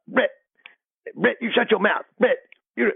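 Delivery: high-pitched imitation of a scolding coach; mock scolding. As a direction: cut the player off, high and insistent. Assistant coach scolds cursing player.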